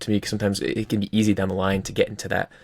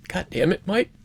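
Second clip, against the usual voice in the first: mock exasperation; 11 semitones higher than usual. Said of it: "and you're like".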